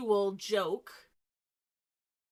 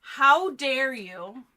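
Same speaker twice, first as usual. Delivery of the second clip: slow, loud, higher outraged gasp; mock outrage; 5 semitones higher, 11 dB louder, much slower than usual. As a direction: play scandalized.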